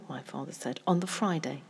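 plain reading voice

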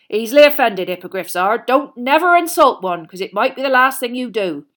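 big booming hearty giant; a jolly loud gamekeeper; Hagrid voice. A narrator's reading.

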